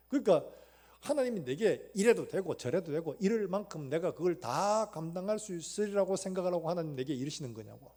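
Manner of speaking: ordinary talk, nothing marked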